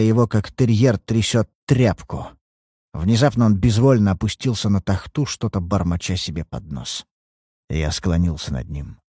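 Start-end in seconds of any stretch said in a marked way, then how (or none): none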